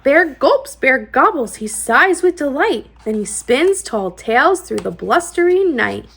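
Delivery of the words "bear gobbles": plain reading voice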